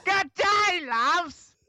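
very high, loud mock Aussie yell; hammed-up Australian greeting; overdone Australian accent. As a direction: go far too strong with a fake Australian accent. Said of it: "goes so strong with an accent".

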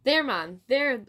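loud cry, rising high on the second THERE; urgent cry. A narrator's reading. Wife showing her husband the bannock, urgent cry. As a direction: point it out urgently. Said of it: "his wife cried".

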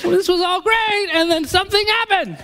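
very high whiny protest; comic dismay. Retold or imitated. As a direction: whine it like a betrayed friend. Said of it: "I'm like".

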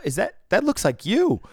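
high, loud shocked exclamation; high, loud surprised quote; surprised girl. Retold or imitated. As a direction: gasp it out in disbelief. Girls react to the card.

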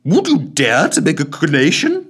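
tipsy collector slurring out a request; drunken slur. Acted. Drunk Santa asking Marley for charity, slurred. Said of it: "he slurred".

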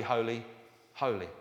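ordinary talk, nothing marked

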